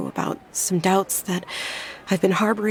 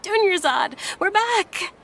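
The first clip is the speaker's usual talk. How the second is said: cheerful shouted greeting; 10 semitones higher, slower than usual. Retold or imitated. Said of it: "waved to me with a smile and shouted".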